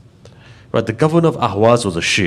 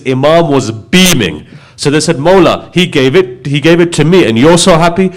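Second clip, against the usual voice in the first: raised, louder sermon delivery; impassioned preaching; 3 semitones higher, 6 dB louder than usual. Acted. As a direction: preach it with rising fervour. Preacher builds to full force.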